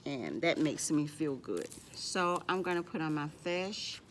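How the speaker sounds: ordinary talk, nothing marked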